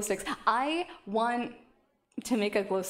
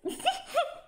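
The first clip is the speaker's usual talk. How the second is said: high giggle. Real, not acted. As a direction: burst of high-pitched giggling. Laughs at her own model.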